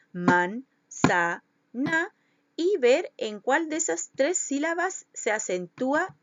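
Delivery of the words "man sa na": "man, sa, na" each syllable on its own. Shown as a demonstration. Teacher separating the word for pupils, "man, sa, na" syllable by syllable. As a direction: separate "man", "sa", "na" with small pauses. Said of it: "separarla en sílaba".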